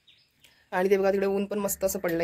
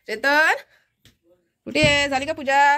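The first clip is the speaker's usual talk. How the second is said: louder, higher call with a rising end; 7 semitones higher, 7 dB louder, slower than usual. Real, not acted. Wife calling Chetan from another room, louder rising call. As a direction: call his name across the house.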